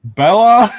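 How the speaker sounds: startled call of her name; a dad finding a shark man in his garage; shocked dad. Retold or imitated. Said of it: "I'm just imagining him seeing that and like".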